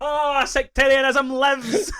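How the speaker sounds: high, slow, laughing sarcastic exclamation; mock sarcastic cheer. Joking about the Protestant line to friends, high slow sarcastic laugh. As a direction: drag it out high as a dry joke while laughing. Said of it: "And I was just like".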